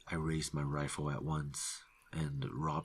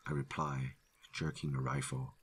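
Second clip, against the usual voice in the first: usual speaking voice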